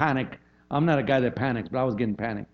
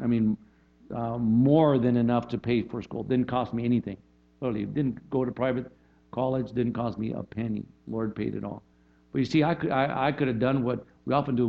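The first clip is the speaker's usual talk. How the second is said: ordinary talk, nothing marked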